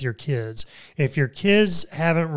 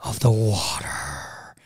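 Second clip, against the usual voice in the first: low, slow, whispered spooky intro; spooky announcer voice; deep scary voice; 5 semitones lower, slower than usual. Pretend. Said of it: "this really deep, scary voice".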